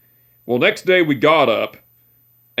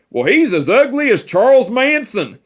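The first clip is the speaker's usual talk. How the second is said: higher, mock-gruff old man scolding; imitating great-grandpa; 8 semitones higher than usual. Great-grandpa teasing his long-haired great-grandson, mock-gruff higher old man voice. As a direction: needle him about his hair like a cranky old man.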